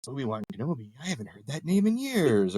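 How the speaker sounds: Obi-Wan Kenobi. Retold or imitated. Quoting Star Wars to a friend, light Obi-Wan impression. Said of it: "And Obi-Wan Kenobi goes".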